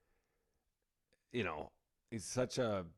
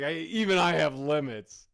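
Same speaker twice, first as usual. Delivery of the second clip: frat guy limits; 3 semitones higher, 11 dB louder than usual. Retold or imitated. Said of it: "He's like".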